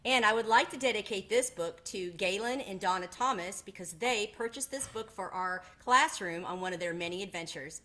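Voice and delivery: ordinary delivery, nothing marked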